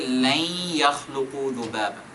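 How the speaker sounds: لَنْ يَخْلُقُوا ذُبَابًا recited quickly with tajweed; tajweed reading of لَنْ يَخْلُقُوا ذُبَابًا. Shown as a demonstration. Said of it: "So with tajweed, you can say".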